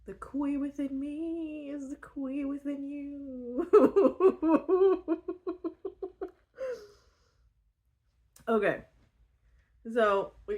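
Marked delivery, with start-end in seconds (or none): 0.1-3.7 high, slow mock-mystic chant through laughter; giggly high mock-sacred chant; laughing mantra. Mock-solemn mantra about the Kui. 3.7-6.3 loud high cackling laugh; real high-pitched fit of laughter; high burst of laughter. Real, not acted. Cracks up after mock mantra.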